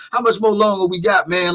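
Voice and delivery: lightly voiced antsy guest; imitating impatient guest. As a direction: ask again like he's desperate to hang up. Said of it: "He's like".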